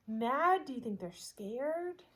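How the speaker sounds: ordinary delivery, nothing marked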